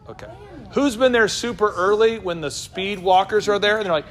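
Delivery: ordinary talk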